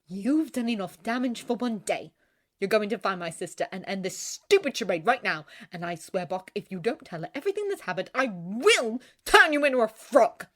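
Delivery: mild, controlled anger rising at the end; disgusted threat. A narrator's reading. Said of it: "watching with disgust".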